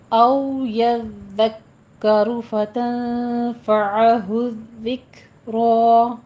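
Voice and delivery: slow measured Quranic chant; tajweed recitation. Shown as a demonstration. Teacher models the verse for students, slow recitation. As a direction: draw each vowel out like a reciter.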